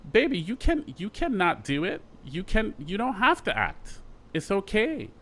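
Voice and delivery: a hint of Tom soothing a worried friend; friend Tom's voice. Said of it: "and he's like".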